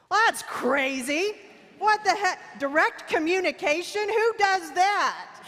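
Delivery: loud, high-pitched comic disbelief; mock incredulous outrage. Speaker balking at her mentor's idea of speaking up, mock outraged. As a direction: blurt it out loud and high, scandalized, hamming it up.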